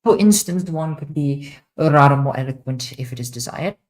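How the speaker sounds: showing off a posh narration voice; posh English accent. Shown as a demonstration. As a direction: play it grand and posh. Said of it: "I would speak in a much more posh accent, for example".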